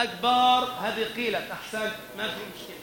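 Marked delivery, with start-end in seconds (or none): 0.0-0.7 أكبر stretched as "akbaaar"; added alif. Shown as a demonstration. Teacher repeats a reported error, extra alif after ba. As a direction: hold a long A after the b in أكبر. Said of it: "الإتيان بألف بعد الباء".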